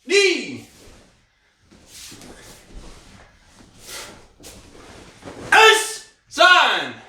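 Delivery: sharp, high shouted counting; kiai count shouts. Real, not acted. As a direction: bark each count out with power. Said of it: "I try to get louder".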